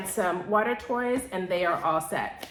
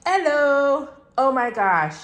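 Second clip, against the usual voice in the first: excited hello; 4 semitones higher, 7 dB louder, slower than usual. Real, not acted. Greeting her new chairs.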